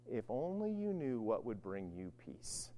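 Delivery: hushed, sorrowful plea; quiet weeping lament. Retold or imitated. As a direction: let it fall to a near-whisper, grieving. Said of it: "And Jesus starts crying".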